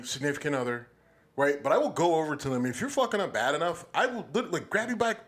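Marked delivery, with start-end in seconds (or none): none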